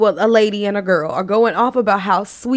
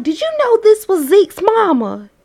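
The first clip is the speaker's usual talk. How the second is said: pitched-up, excited country woman; retold country mama; 10 semitones higher than usual. Mother checking with her daughter about Zeke's mama, excited country voice. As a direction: lift it high like an amazed mama. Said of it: "And she was like".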